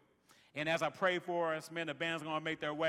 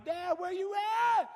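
high mock shout of a child; daughter's high-pitched holler; imitated calling out; 13 semitones higher, 6 dB louder than usual. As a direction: call out for Dad across a distance. Daughter calls back to lagging dad.

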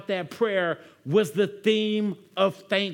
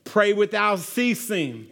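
slow scripture reading; slower than usual. Acted. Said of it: "Verse seventeen".

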